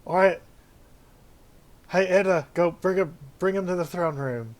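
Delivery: loud call across the gate. Acted. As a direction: shout an order to a guard. Dwarf calling out to Edda.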